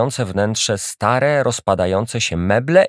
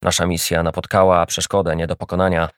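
mocking; 4 semitones lower than usual. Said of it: "drwił dalej".

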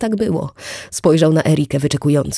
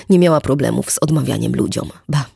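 usual speaking voice

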